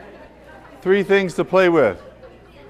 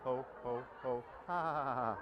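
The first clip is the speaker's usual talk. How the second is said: deep belly laugh; 5 semitones lower, 21 dB quieter than usual. Shown as a demonstration. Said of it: "help me with the next exercise".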